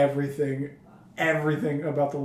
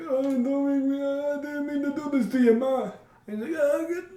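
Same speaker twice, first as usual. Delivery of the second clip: high, pleading son voice; whiny son; 10 semitones higher than usual. Retold or imitated. A son pleading with his scary mother, voiced much higher. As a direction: beg like a scared grown boy. Voicing the son in a movie recap.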